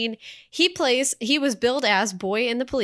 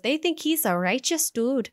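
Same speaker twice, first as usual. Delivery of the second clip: put-on Minnesota accent; 3 semitones higher than usual. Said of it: "Midwestern slash Minnesota, Wisconsin accent".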